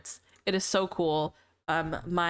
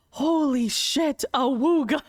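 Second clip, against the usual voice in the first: slow, higher, louder gasp of delight; mock excited squeal; 5 semitones higher, 5 dB louder, slower than usual. Girls reacting to her new outfit, slow excited awe. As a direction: draw it out like an awed fan.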